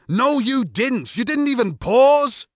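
high, loud bickering retort; worked-up, high-pitched protest; indignant contradiction. A narrator's reading. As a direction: argue back, thoroughly put out. Lister contradicts Rimmer, bickering.

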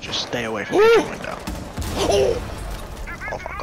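high, fast, loud panic; panicked shout. Real, not acted. Panicked callout in game.

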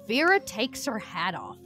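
usual speaking voice

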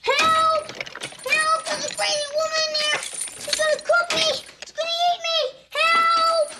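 high, loud panicked shrieks for help; terrified screaming. Acted. Boy in the pantry screaming the woman will eat him. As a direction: scream that she'll eat you.

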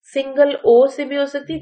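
ordinary talk, nothing marked

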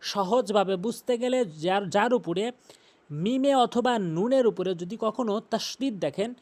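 ordinary talk

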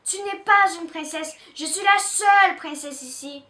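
big sister declaring herself the only princess; raging. Acted. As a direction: stamp down on pas. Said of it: "rage Pistache".

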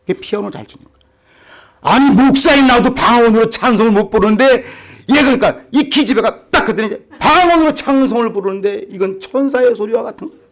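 ordinary talk, nothing marked

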